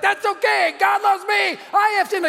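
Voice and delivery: high-pitched imitation of a chattering little boy; insistent child. Son butting in on dad's talk, imitated insistent child voice. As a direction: pipe up high like a five-year-old who won't stop. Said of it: "my son Jeremy won't shut up".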